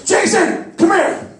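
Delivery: high urgent call to a friend; excited call. Retold or imitated. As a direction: call him over, thrilled. Calling friend over excitedly.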